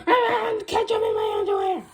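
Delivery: loud, high laughing yell; outraged. Acted. Principal shouting from the restroom, high laughing yell.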